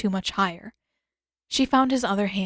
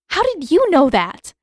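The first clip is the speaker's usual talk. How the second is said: high, loud demand; 8 semitones higher, 8 dB louder than usual. A narrator's reading. Katara demands the truth from Yugoda, loud and high. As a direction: insist on an answer, raised and sharp. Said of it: "Katara demanded".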